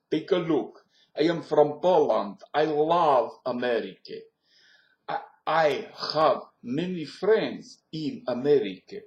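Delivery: loud, emphatic Polish accent. Shown as a demonstration. Pole telling of love for America, Polish accent. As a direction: declare it in a put-on Polish accent. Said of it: "lose the German accent and get into Polish".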